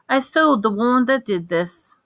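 ordinary delivery, nothing marked

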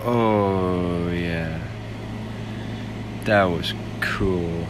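usual speaking voice

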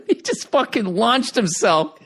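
ordinary delivery

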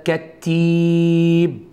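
كتيب drawn out slowly with a rising end; slow dictation. Shown as a demonstration. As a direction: stretch كتيب, lift the last syllable. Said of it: "صيغه المبالغه".

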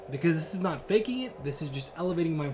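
normal talking voice

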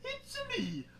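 very high, excitable game-mascot voice; Mario. Retold or imitated. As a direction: squeal it out like a cartoon plumber. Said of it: "it kinda sounded like Mario".

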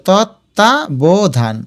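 তত্ত্বাবধান slowly as tot, ta, bo, dhan; syllable by syllable. Shown as a demonstration. Teacher helps students place hasanta, তত্ত্বাবধান slowly split. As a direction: separate each beat of তত্ত্বাবধান. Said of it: "এই যে".

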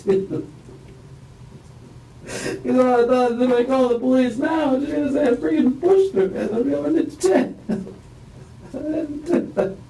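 very high, strained voice with nervous laughs; upset, near tears. Real, not acted. Man answering the interviewer about her injury, very upset and high. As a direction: voice cracking high as he relives it. Describing her unresponsive, very distressed.

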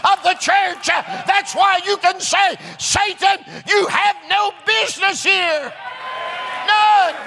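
high, driving, shouted preacher cadence; full-force preaching. Real, not acted. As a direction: preach it hard, hammering each phrase toward a shouted climax.

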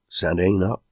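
plain reading voice

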